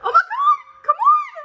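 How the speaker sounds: high, frightened cry of alarm; panicked cry. Real, not acted. Alone as the storm hits, a high panicked cry.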